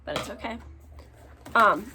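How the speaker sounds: ordinary talk, nothing marked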